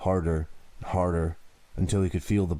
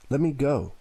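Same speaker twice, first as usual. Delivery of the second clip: high desperate plea to be let go; desperate cry; 8 semitones higher than usual. Acted. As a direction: cry out to be freed, pitch rising. Tied man pleading to be freed.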